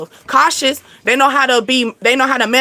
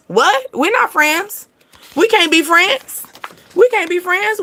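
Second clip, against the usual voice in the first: indignant suitor; 7 semitones higher than usual. Acted. Acted friend-zone protest.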